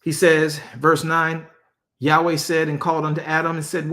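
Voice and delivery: normal talking voice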